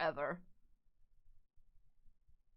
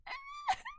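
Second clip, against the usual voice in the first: very high, small squealed noise; high squeak; 7 dB quieter than usual. Acted. Streamer acting out her reaction, high quiet squeal. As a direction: squeak wordlessly in mock reaction. Wordless reaction after "I'm like.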